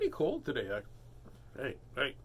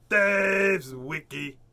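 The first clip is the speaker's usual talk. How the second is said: drawn-out loud announcement; slow dramatic announce; 3 semitones higher, 7 dB louder, much slower than usual. Pretend. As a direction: stretch the name out grandly. Dramatic slow name call.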